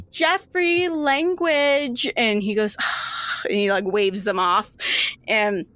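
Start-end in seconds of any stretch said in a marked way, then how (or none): 0.1-2.1 high scolding call across the room; nurse calling out. Acted. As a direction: call out a warning from far across the ward. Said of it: "a nurse from the other end of the room goes".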